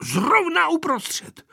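put-on high, insistent old woman; astonished granny showing the spot; agitated grandmother. A narrator's reading. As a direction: burst out high, then draw it out. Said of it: "ukazovala babička a prst se jí třásl".

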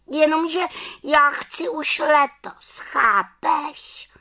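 very high, pleading witch voice; insistent little witch. Acted. Little witch wanting to go this year, high insistent voice. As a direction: insist like an impatient small witch, pitched high.

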